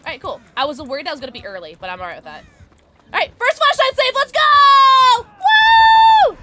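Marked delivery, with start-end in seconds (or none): 3.1-6.4 high, loud celebratory yell; triumphant shout. Real, not acted. Player celebrating her first flashlight save, triumphant. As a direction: shout it out high, ending in a whoop.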